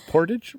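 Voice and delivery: questioning portage as POR-tij, rising at the end; mocked American pronunciation. Shown as a demonstration. Camper teasing American viewers, portage as incredulous POR-tij. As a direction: query portage with a short "tij" ending. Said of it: "how do Americans say it?".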